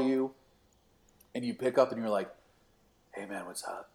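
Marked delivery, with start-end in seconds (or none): 3.1-3.9 whispered, sleepy phone pickup; just-woken voice. Retold or imitated. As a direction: imitate someone barely awake. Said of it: "did I wake you up".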